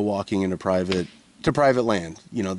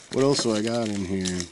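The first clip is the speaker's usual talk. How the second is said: ordinary talk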